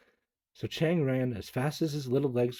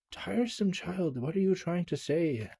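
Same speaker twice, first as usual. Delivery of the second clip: weary mother; 7 semitones higher than usual. Acted. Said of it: "said his mother".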